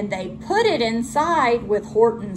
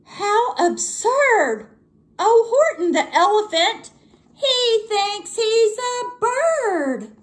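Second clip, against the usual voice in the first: mocking yell; 11 semitones higher than usual. A narrator's reading. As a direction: stretch it out to ridicule him. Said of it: "they yelled".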